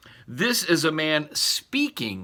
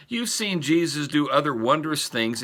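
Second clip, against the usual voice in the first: ordinary talk, nothing marked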